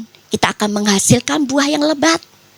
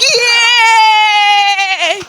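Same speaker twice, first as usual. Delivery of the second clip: loud, high, drawn-out whoop; gleeful cheer; 7 semitones higher, 5 dB louder than usual. Retold or imitated. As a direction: burst out cheering over a windfall. Retells joy at receiving money.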